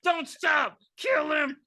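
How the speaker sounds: high, screaming killer doll orders; a voiced Chucky yelling at the top of his lungs; Chucky's furious shouting. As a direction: shriek the commands like a possessed doll egging on murder.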